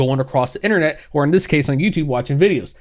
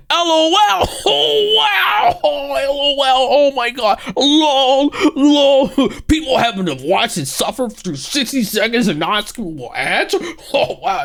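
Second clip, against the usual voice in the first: mock laughing; 8 semitones higher, slower than usual. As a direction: laugh at the post in disbelief. Mocking the post's LOL.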